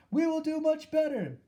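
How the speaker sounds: a crowd of witches eager to please; murmured reply. A narrator's reading. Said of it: "murmured the audience".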